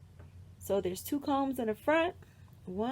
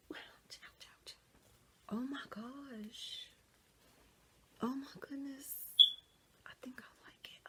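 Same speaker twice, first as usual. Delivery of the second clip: whisper; 7 dB quieter than usual. Real, not acted. Quietly reacting while trying wig on.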